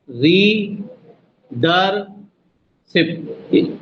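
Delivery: रीडरशिप stretched very slowly; drawn out. Shown as a demonstration. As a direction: hold each beat of रीडरशिप. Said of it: "तीन हो गए।". (रीडरशिप खींचकर)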